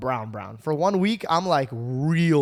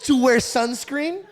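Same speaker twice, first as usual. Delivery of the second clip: high, loud and drawn-out disbelief; hammed-up incredulous question; mock incredulous; 10 semitones higher, 5 dB louder, slower than usual. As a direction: stretch it out in mock amazement. End of his scoffing retold reply.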